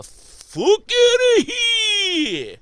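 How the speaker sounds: put-on old man yelling at younger self to leave; drawn-out yell. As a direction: stretch the warning into a yell. Old [name] warning young self.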